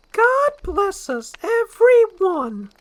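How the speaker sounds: very high, loud, slow; high little cookie voice; squeaky gingerbread man. Acted. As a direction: cry it out.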